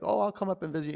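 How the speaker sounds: high, singsong promise he doesn't believe; mocking students' promise. Yoga teacher mimics students who never visit, mock-cheerful. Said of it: "You're like".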